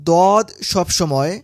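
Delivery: normal talking voice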